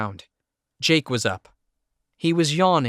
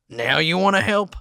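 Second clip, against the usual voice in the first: angry; 7 semitones higher, 4 dB louder than usual. A narrator's reading. Bitter retort to offer.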